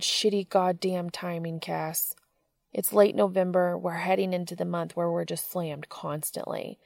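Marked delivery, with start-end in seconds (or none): none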